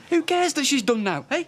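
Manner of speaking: loud, high-pitched shouting; angry outburst. Acted. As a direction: shout it as a furious challenge. Bitter outburst in a quarrel.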